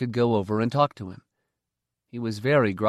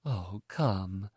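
chiding but amused; 2 semitones lower, 8 dB quieter than usual. Acted. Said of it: "chiding but amused".

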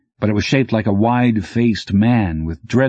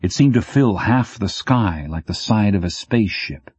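ordinary delivery, nothing marked

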